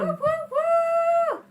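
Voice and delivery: high, loud whoops; cheering. Real, not acted. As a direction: whoop for the performer. Cheering the performer.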